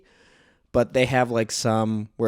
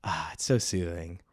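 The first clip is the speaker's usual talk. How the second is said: blissed-out sigh; 7 semitones lower, 7 dB quieter than usual. Retold or imitated. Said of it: "and I'm like".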